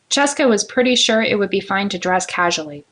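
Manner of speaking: ordinary delivery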